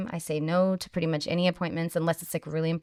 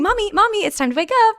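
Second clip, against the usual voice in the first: put-on much higher, louder child calling Mommy; little boy voice; 6 dB louder than usual. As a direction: play the eager toddler waking mom. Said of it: "He'd be like".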